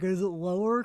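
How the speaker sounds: low exploratory character voice. Shown as a demonstration. Testing a character's voice for the host, low and searching. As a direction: test the voice, dropping it. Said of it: "kinda like".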